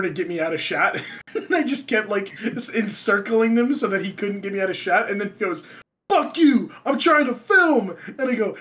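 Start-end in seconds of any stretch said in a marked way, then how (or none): 6.1-8.0 raised, high-pitched shout of exasperation; angry mock yell. Imitating the man whose shot was blocked, exasperated shout. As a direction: yell it like a fed-up cameraman, stretching the end. Acted outburst of interrupted filmer.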